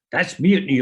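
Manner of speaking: retold officer's stern indignation; angry rebuke. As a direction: put him in his place. Captain rebukes the lieutenant.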